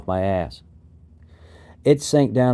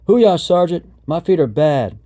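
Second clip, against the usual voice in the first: raised, urgent plea to a superior; pleading; 5 semitones higher, 4 dB louder than usual. A narrator's reading. Trainee begs his sergeant about bad feet, pleading. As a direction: plead your case, starting high and loud. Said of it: "I pleaded".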